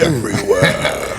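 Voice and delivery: very slow, stretched Pacino take; Al Pacino impression. Mimicking Pacino for friends on the podcast. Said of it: "you can do Al Pacino?".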